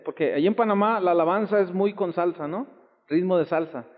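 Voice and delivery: ordinary delivery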